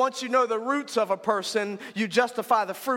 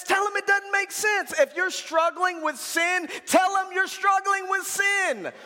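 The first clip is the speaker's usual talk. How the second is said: high-pitched, rhythmic preaching cadence; 7 semitones higher than usual. Real, not acted. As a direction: preach it in matched, building phrases.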